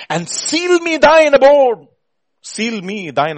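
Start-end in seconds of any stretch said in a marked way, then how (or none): 0.1-1.9 slow, high, loud plea; impassioned cry. Real, not acted. Preacher begging God to dwell in him, impassioned cry. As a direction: belt it out as a drawn-out prayer. Cries hymn line, then repeats.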